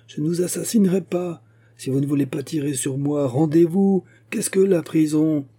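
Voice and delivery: urgent appeal. A narrator's reading. Musketeer urging his enemy to surrender, urgent appeal. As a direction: press him hard to surrender.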